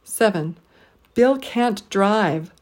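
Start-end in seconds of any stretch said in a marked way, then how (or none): 1.1-2.5 Bill, can't, drive each stressed slowly, drive stretched up then down; slow three-beat Bill can't drive; deliberate stress on every word. Shown as a demonstration. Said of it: "stress three syllables in each phrase".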